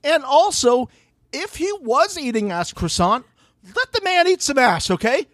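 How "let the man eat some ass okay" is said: high, insistent scolding; emphatic defense. Real, not acted. Defending cast member emphatically.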